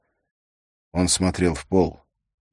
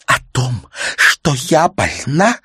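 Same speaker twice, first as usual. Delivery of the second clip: high, loud outburst, drawn out; acted exclamation of despair; anguished cry; 9 semitones higher, 8 dB louder, slower than usual. A narrator's reading. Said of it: "вскрикнула та".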